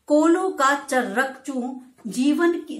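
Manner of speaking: slow, measured verse reading; poem recitation. Shown as a demonstration. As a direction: recite the line slowly, like a poem. Teacher recites poem lines. (धीमा काव्य पाठ)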